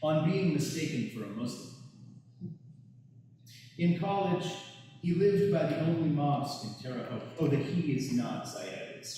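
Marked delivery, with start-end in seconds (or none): 0.0-7.2 measured, deliberate poetry recital; poem reading. Acted. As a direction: read the poem line by line, with pauses. Reads own poem aloud.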